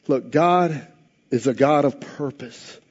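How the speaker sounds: ordinary delivery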